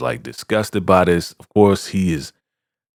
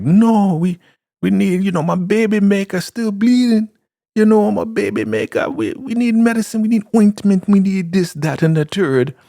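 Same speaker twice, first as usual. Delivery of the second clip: much higher, insistent pleading voice; pleading Elder Mother; 13 semitones higher, 3 dB louder than usual. Retold or imitated. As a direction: squeak out the plea for medicine.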